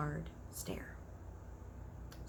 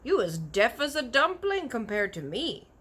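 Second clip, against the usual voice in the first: giant's cry; 6 semitones higher, 7 dB louder than usual. A narrator's reading. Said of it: "cried the B F G".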